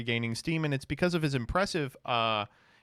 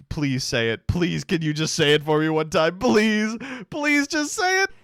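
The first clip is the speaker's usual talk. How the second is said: mock begging; 3 semitones higher, 5 dB louder than usual. Begging the clip to say it.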